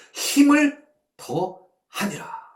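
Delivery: high and drawn out, slow; slow emphatic reading. Shown as a demonstration. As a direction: lean on each syllable of 힘을 더하니라. Reading key verse phrase.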